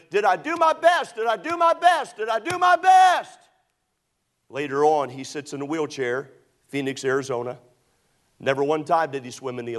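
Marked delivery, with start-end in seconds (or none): 0.1-3.4 very high, full-throated shout, rising to the last; yelling as loud as he can. Retold or imitated. Swimmer waking from sedation shouts at full force. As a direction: scream it with everything, desperate. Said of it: "he'd yell it as loud as he could".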